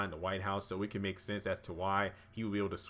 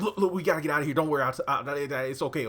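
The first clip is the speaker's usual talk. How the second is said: loud, high, flustered reassurance; comic stammering panic; retold panic; 6 semitones higher, 7 dB louder, faster than usual. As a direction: act out scrambling to get out of trouble. Retold man hurrying out, placating her.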